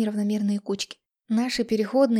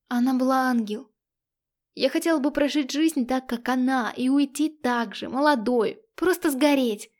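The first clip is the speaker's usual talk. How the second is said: higher, louder passionate outburst; almost shouting; 4 semitones higher, 4 dB louder than usual. Acted. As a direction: nearly shout it, carried away. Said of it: "Чуть не кричала я". (почти крича, страстно)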